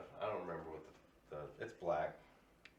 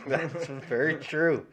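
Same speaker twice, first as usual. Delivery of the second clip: laughing; 7 semitones higher, 15 dB louder, much slower than usual. Real, not acted. Laughing agreement.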